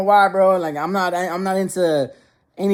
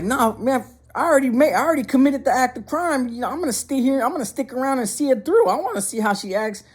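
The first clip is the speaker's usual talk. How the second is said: higher-pitched, acted insistence; resolute self-talk; 7 semitones higher than usual. Son deciding to stay after pranking mom, high insistent self-talk. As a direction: talk yourself into seeing it through. Son talks himself into staying.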